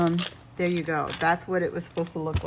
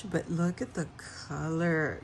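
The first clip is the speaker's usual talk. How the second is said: normal talking voice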